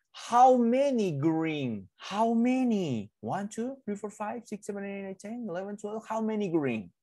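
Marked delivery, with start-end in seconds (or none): 0.1-1.9 slow, drawn-out "How many green?"; reading to a toddler. Shown as a demonstration. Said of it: "modelled slowly, then asked again". 2.0-3.1 put-on slow, drawn-out question to little kids; reading to a toddler. Teacher asks toddlers, drawn out. 3.2-6.1 gentle counting under the breath; soft counting. Acted. Teacher counts crayons for kids.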